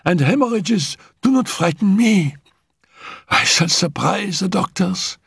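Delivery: high, breathless invalid's voice; a dying girl insisting she'll live; feverish sick girl. Acted. Dying Annette tells Raoul she will live, high and feverish.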